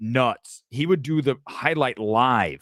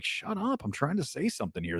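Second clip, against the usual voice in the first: much softer hissed aside; muttered snap; 2 semitones higher, 8 dB quieter than usual. Retold or imitated. To producer talking in his ear, softer muttered snap. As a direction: grumble it under your breath.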